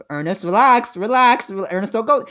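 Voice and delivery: playful outcry at a writer; mock pleading. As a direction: beg him to stop, hammed up.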